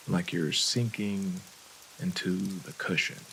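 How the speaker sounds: calm, unhurried relaxation coaching; guided meditation. Real, not acted. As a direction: gently guide them through deep breaths. Leads a breathing meditation.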